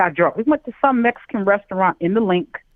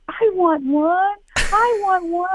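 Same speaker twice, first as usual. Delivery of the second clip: put-on childish begging; 10 semitones higher, much slower than usual. Begging for the drink.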